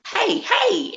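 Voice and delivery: playful high hello; mock greeting. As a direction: call out a bright greeting. Wrestlers greeting voiced.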